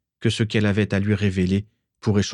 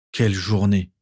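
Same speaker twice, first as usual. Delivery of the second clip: plain reading voice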